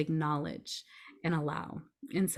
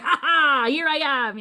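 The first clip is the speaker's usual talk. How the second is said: much higher and louder mock-villain ha ha; gloating burst; 10 semitones higher, 10 dB louder than usual. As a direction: burst out and take over the bus. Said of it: "she's like".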